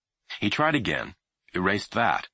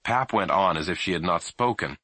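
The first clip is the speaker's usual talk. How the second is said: ordinary delivery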